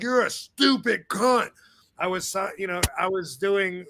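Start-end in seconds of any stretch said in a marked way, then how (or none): 0.0-1.5 high, slow, drawn-out jeer; mock hostile insult. Shown as a demonstration. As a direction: overdo it as an exaggerated nasty insult. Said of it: "And I don't talk like".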